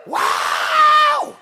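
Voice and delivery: piercing high whoop, full-throated; imitated war whoop. Preacher imitating a woman's war whoop in church, piercing whoop. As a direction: let out a wild war whoop. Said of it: "Comanche war whoop".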